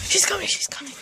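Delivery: urgent hushed whisper. Real, not acted. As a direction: whisper so grandma won't hear. Hiding from grandma at door.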